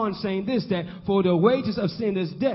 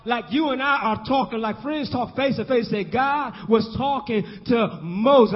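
rhythmic sermon cadence, stretching the big words; fervent preaching; 5 semitones higher, 3 dB louder than usual. Real, not acted. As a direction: preach it with rising force.

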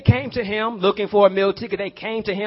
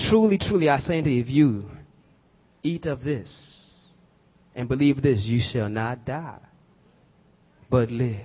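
solemn scripture; 6 semitones lower, 4 dB quieter than usual. Acted.

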